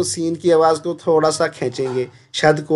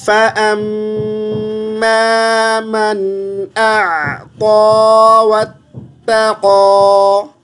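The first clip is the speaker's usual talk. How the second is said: slow melodic tilawat chant; Quran recitation; 4 semitones higher, much slower than usual. Shown as a demonstration. Teacher recites the verse for the student, slow tilawat. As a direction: recite it slowly, drawing out each vowel.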